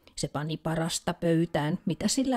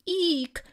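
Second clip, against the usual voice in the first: sudden high squeak, much higher; high squeal of fright; 12 semitones higher than usual. A narrator's reading. Country mouse spotting the cat, sudden high squeal. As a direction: shriek it like a startled mouse. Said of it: "huusi Siiri äkkiä".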